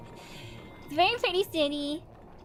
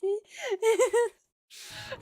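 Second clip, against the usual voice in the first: high, slow, squealed through laughter; helpless laughter; 9 semitones higher, slower than usual. Real, not acted. Streamer explaining the accidental insult, helplessly laughing. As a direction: barely get it out for laughing. Flustered apology dissolving into laughter.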